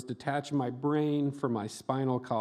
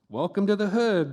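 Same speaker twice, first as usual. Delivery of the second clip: slight Southern drawl; 7 semitones higher, 4 dB louder than usual. Retold or imitated. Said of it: "the man said in a slight drawl".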